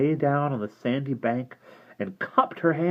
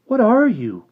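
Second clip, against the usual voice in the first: high, loud startled cry; alarmed girl crying out; frightened cry; 11 semitones higher, 7 dB louder than usual. Acted. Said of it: "cried Derna".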